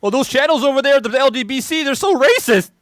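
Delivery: mocking a hypocrite complaining about racism, high and loud; mock whiny accuser. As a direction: whine it like a hypocrite crying foul. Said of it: "people that cry racism".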